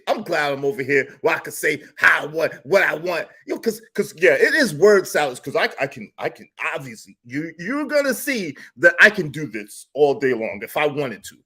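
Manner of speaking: ordinary delivery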